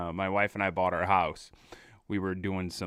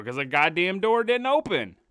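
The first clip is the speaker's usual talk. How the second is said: high, indignant comeback; exasperated retort; 8 semitones higher, 3 dB louder than usual. Retold or imitated. Customer answering the nagging worker, exasperated and high. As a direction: fire back in exasperation. Retelling his retort to the lady.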